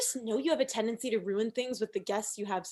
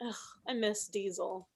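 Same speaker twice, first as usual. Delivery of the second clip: usual speaking voice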